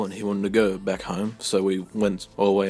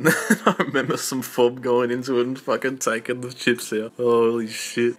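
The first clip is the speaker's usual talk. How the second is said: laughing; 4 semitones higher, 4 dB louder than usual. Real, not acted. Laughing retelling gross moment.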